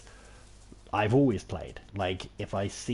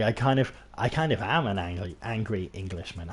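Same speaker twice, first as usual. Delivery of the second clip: ordinary talk, nothing marked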